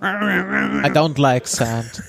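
very slow sulky drawl; slow, brooding Anakin quote. Retold or imitated. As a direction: mope each word out. Quoting Anakin's famous line.

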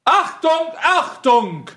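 loud herald calling out; herald shout. Acted. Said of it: "haut auf den Boden und sagt".

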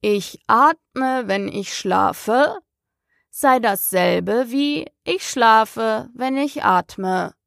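slow, drowsy sleep-talk; talking in his sleep. A narrator's reading. The Dormouse chimes in from its sleep, slow and drowsy. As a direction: murmur it slowly as if still asleep. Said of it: "das im Schlafe zu sprechen schien".

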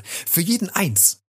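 high, drawn-out gleeful cry; eager child crowing; excited call. A narrator's reading. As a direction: stretch it out high and slow. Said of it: "rief Rotznase".

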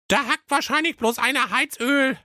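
put-on squeaky high girl's voice; high-pitched cartoon princess; high sister voice. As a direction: pipe it up like a tiny princess. Sister answers in story.

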